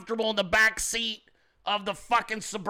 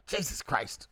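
normal talking voice

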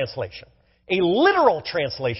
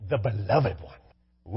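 savoured; 13 semitones lower, 6 dB quieter, much slower than usual. Acted. Savouring the scripture phrase.

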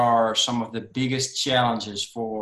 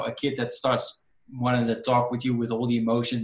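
normal talking voice

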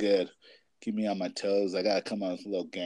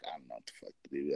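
low mutter; 9 semitones lower, 11 dB quieter, much faster than usual. Retold or imitated. Retelling helplessness with sick child.

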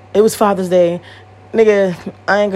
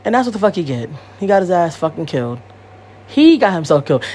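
usual speaking voice

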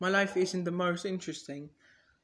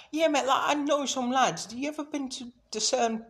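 higher, louder matey pub-bloke voice; lightly hammed-up Brit chatting about pubs; mock British lad; 8 semitones higher, 4 dB louder than usual. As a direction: play a chummy lad down the pub. Podcast is like.

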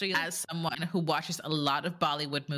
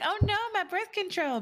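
much higher, laughing mock alarm; mock protest. Wife pretending to protest, in a high, giggling mock alarm. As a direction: fake clutch-your-pearls squeal. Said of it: "I'd be like".

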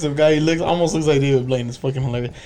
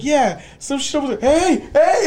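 high excited call; 7 semitones higher than usual. Retold or imitated. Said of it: "He's like".

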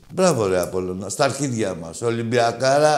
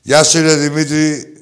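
loud greeting; 4 semitones higher, 9 dB louder than usual. Real, not acted. Host greets a listener on air.

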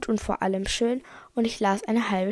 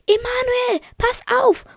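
high-pitched urgent cry; warning yell; 13 semitones higher, 3 dB louder than usual. A narrator's reading. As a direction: call out a warning in a much higher voice. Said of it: "brüllte ich".

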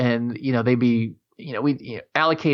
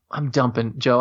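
usual speaking voice

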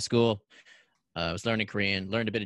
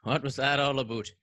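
put-on loud, high comic Canadian accent; hammed-up Canadian accent; 6 semitones higher, 8 dB louder, faster than usual. Guest answering the host's teasing, broad mock Canadian accent.

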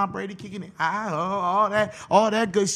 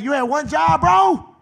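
much higher and louder, rising at the end; exasperated yell; 7 semitones higher, 7 dB louder, slower than usual. Acted. Scolding the player who blew his last chance, high loud yell. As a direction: throw up your hands and shout it.

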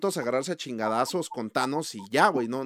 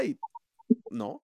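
normal talking voice

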